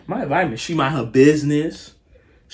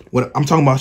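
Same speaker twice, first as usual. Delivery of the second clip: ordinary delivery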